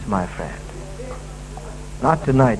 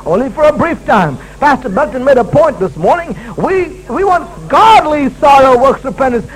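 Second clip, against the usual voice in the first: usual speaking voice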